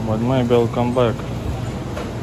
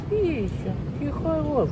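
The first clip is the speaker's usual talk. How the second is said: cooing to a cat; 5 dB quieter than usual. Real, not acted. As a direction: coo at the kitty.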